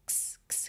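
ks whispered, no vowel; whispered ks sound. Shown as a demonstration. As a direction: hiss the ks cluster sharply. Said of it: "K S direto". (ks sussurrado, sem vogal)

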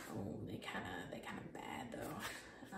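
soft unvoiced whisper. Real, not acted. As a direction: whisper it to yourself. Whispered aside looking at sketches.

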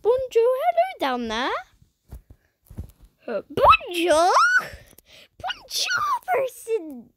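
playful greeting. Pretend.

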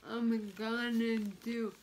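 ordinary delivery

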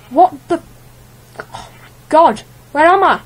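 loud, high, startled; shocked. Real, not acted. Gamer respawns somewhere unknown, loud shocked outburst. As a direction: blurt it out in alarm. Wakes in strange place.

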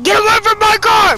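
high, loud yell at the thief; shouted order. Real, not acted. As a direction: chase him off your car. Yelling at player near car.

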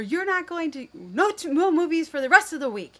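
loud, high, fast yelled threat; yelling punishment. Retold or imitated. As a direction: blurt out the punishment at full volume. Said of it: "yelling at, to my son".

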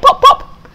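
high squeaky mock pops; popping sound. Pig imitating eyes popping, high squeaky pops.

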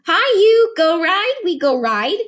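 put-on a toddler begging for a ride. Toddler Boots greets roach.

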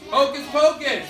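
lively chanted call to the class; sing-song classroom callback; attention call. Acted.

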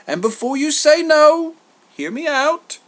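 high, loud pleading pitch; insistent Zeus. Acted. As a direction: press the case before being refused. Zeus presses his pitch.

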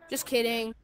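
ordinary talk, nothing marked

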